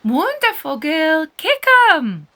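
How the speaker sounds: high, loud cheering yell; shout. A narrator's reading. Wonderful shouting during beach football, loud cheer. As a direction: egg on your teammate at full voice. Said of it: "shouts Wonderful".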